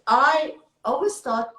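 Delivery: ordinary talk, nothing marked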